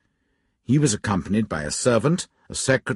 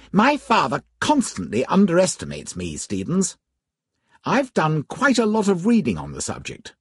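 put-on young gentleman voice; 9 semitones higher than usual. Narrator voices young Cardinal.

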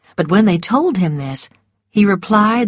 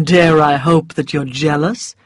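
teasing, lightly lower man's voice; smiling; 3 semitones lower, 3 dB louder than usual. A narrator's reading. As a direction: tease her with a smile.

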